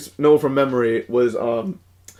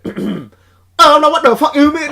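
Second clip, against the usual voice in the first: high, slow put-on Vietnamese accent; mock Vietnamese accent; 13 semitones higher, 5 dB louder, slower than usual. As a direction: snap back in a hammed-up Vietnamese accent. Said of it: "my, uh, Vietnamese accent".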